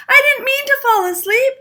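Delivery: very high, loud startled cries; a girl caught, panicking; cried out. A narrator's reading. Goldie apologizing in panic.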